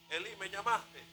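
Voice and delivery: soft, small-voiced question; hushed young servant. Boy asks the old priest if he called, softly. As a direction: ask it quietly, as if at a bedside. Boy Samuel answers God's call.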